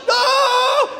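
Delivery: a hammed-up panicked yell; Moses screaming. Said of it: "Moses is like".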